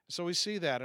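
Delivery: normal talking voice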